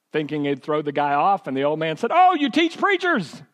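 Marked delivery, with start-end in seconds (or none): 2.1-3.4 much higher, louder delighted surprise; light up like you just struck gold; surprised old man. Retold or imitated. Old man reacts to homiletics.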